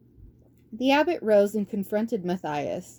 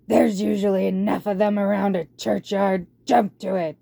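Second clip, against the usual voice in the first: loud, rough warlord barking orders at his horde; a tyrant rat commanding his troops; 5 dB louder than usual. Acted. Warlord assigns tasks.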